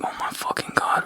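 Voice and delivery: stunned whisper under the breath. Retold or imitated. Thinking to themselves about the cop, whispered. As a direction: hiss it out in disbelief.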